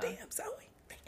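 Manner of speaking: hushed disappointed whisper. Retold or imitated. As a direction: breathe it out in dismay. Whispered shocked reaction.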